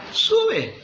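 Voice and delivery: high, mocking ironic "Sube". Micaías mocking the king, ironic "Sube". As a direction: say it with an ironic smile. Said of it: "quizás con una sonrisa irónica".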